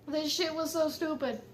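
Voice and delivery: ordinary talk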